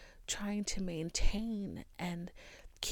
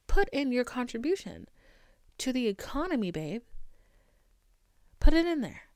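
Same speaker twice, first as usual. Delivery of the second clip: loud, high sarcastic push; mocking demand; 5 semitones higher, 6 dB louder than usual. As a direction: sarcastically order them to buy.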